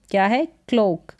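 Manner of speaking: normal talking voice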